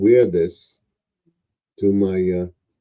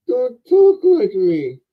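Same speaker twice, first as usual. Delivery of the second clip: much higher, slow mocking echo; mimicking mockingly; 13 semitones higher, 5 dB louder, slower than usual. Elf toy mimicking the man's words back, high and slow. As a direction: parrot him back in a high teasing voice. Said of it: "so I'm talking like you".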